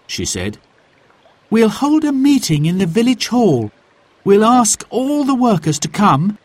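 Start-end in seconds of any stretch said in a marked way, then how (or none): 1.5-6.4 put-on much higher, louder girl with a plan; Mary proposing a village meeting, keen; eager girl. Mary telling her uncle her plan, higher eager girl's voice.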